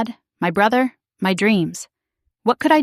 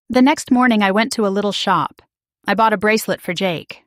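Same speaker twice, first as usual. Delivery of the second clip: plain reading voice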